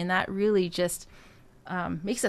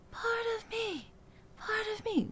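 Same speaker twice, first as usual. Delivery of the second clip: high, soft and thin, breathy head voice; thin wispy wrong way; 11 semitones higher, 9 dB quieter than usual. Shown as a demonstration. Coach showing the wrong weak airy version. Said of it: "And it's also not this".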